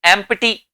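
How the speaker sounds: "एम्प्टी" in three syllables, em-pi-tee; wrong pronunciation. Shown as a demonstration. Teacher repeating the mistaken form, p pronounced. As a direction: sound the p with a vowel in "एम्प्टी". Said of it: "नहीं बोलते इसे".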